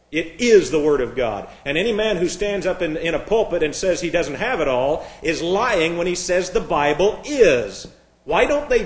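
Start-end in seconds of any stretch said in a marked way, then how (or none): 0.1-1.5 "is" punched, then falling away; stress on IS. Shown as a demonstration. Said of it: "I'm stressing the word is". 6.5-7.9 slow, with "is" drawn out; stress on IS. Shown as a demonstration. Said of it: "I'm stressing the word is".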